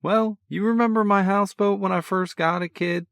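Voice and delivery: a cocky big brother, full of energy; brash older brother. A narrator's reading. Older brother teasing on the phone, brash and cheerful. Sean voice.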